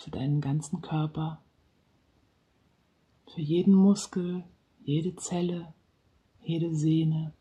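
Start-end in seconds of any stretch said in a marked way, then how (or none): none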